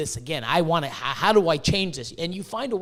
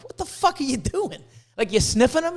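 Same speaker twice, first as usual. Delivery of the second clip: incredulous; 6 semitones higher than usual. Retold or imitated. Acts out questioning egg whisperer.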